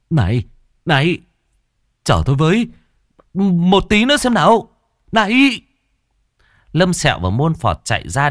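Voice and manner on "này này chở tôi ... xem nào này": rising, louder shouts after them; calling after them. A narrator's reading. Cậu shouting after his fleeing men, higher and louder.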